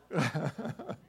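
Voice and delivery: amused chuckle; laugh. Real, not acted. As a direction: laugh at your own joke. After mock husband line.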